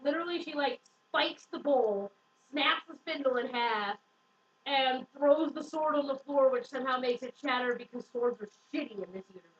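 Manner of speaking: usual speaking voice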